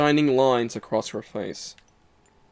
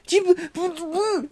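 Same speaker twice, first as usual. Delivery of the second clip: much higher, slow stammer; squeaky stammer; slower than usual. Pretend. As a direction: sputter in a cartoon squeak.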